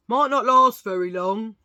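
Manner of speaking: hammed-up dentist's warning. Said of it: "That's the dentist's voice".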